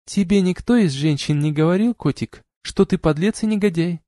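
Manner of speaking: lightly voiced woman; female voice. A narrator's reading. Arna's voice, far timbre.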